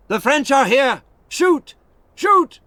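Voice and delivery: high, loud urgent yells; alarm shout. A narrator's reading. English guard alerting the fort, urgent shouting. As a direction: raise the alarm from the wall. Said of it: "An English voice shouted".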